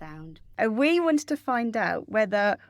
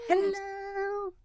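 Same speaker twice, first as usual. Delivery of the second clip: high sing-song hello; 11 semitones higher than usual. Acted. Greeting back on air, high.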